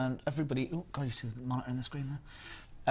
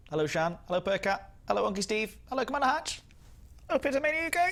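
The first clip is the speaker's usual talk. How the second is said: each hello pitched higher and louder; rising sing-song greetings; 11 semitones higher, 3 dB louder, faster than usual. Pretend. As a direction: climb up the scale with every hello.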